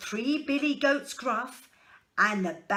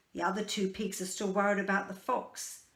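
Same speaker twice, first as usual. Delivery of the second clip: ordinary delivery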